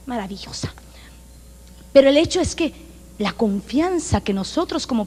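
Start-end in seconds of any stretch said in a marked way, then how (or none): 0.0-0.7 soft proud whisper. Real, not acted. Pastor whispers praise of herself as mother, softly. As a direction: breathe the word out. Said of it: "Ahí va la mamá".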